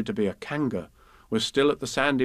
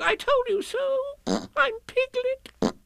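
very high squeal, drawn out at the end; insistent Piglet; slower than usual. A narrator's reading. Piglet telling Kanga so, insistent squeak. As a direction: squeal it, stretching the name.

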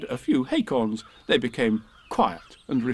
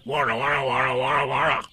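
rolling growly animal noise; continuous rumbling warra-warra roar; tiger war cry. A narrator's reading. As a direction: run the noise together like an animal attacking. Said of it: "And with one loud".

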